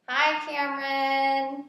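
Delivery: high, slow, sing-song hello; cheery greeting. Real, not acted. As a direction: stretch the hello out warmly. Thanking a sponsor, greets him directly.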